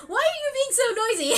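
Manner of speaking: high, fast, laughing scold; mock parental scolding, fast and high; mock scolding parents. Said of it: "scolding me".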